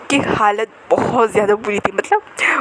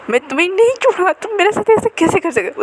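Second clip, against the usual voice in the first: higher, fast angry shout at him; angry outburst; 7 semitones higher than usual. Acted. Girlfriend bursting into his meeting, furious shout. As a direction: storm in and let him have it. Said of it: "उसका गुस्सा देखे".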